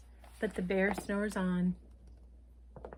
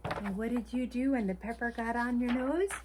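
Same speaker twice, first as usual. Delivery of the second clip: ordinary delivery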